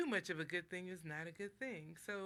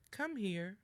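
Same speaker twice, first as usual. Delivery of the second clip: friendly "Come here" with a light rise on "come"; gentle invitation; 2 semitones higher, 5 dB louder than usual. Shown as a demonstration. Mother calling a child kindly, friendly rise. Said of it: "if you hear the first".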